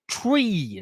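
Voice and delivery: "Tree" with a long, stretched, high ee; over-articulated TREE. Shown as a demonstration. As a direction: stretch the ee in "Tree" with full mouth muscles. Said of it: "Look at the mouth".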